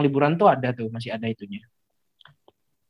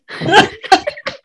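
loud high laugh; 7 dB louder than usual. Real, not acted. Laughing at podcast joke.

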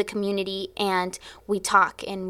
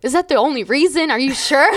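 incredulous; 5 semitones higher, 5 dB louder than usual. Retold or imitated. Incredulous question to pastor.

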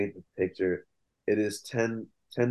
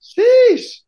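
high, loud sheesh; shocked sheesh; 14 dB louder than usual. Real, not acted. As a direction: yelp it in disbelief. Reacting to a year gap.

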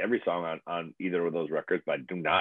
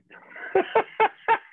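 high burst of laughter; 5 dB louder than usual. Real, not acted. Laughing at the professor's point, high laugh.

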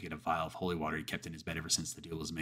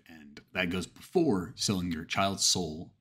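ordinary delivery

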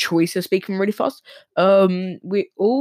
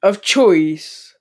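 louder redo; 5 dB louder than usual. Shown as a demonstration. Host redoes "Of choice" louder after calling it pathetic. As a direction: say "Of choice" again much louder, punched out. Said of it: "that was a bit pathetic".

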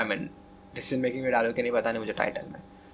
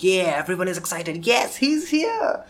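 excited cheering; 6 semitones higher than usual. Pretend. Imagined crowd cheering entrance.

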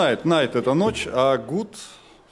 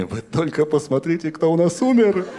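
joyful cry; 5 semitones higher, 3 dB louder than usual. Acted. Heaven welcome quoted with cries.

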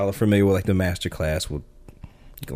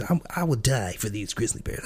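quiet, intense and earnest; intense vow; 7 dB quieter than usual. Retold or imitated. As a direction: swear it with quiet conviction.

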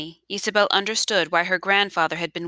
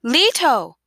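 high, loud call with a falling tail; anguished cry; 6 semitones higher, 6 dB louder than usual. A narrator's reading. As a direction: cry out after someone leaping away. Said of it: "Isabel cried".

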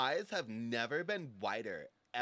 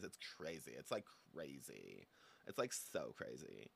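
trailing off; 10 semitones lower, 10 dB quieter than usual. Acted. Trailing off, voice dropping.

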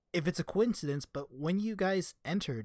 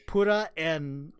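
usual speaking voice

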